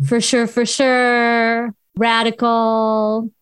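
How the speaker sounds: put-on slow, drawn-out Valley girl slang; lazy, elongated Valley girl delivery. Shown as a demonstration. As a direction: stretch it out like a Southern California Valley girl. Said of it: "I am a Valley girl".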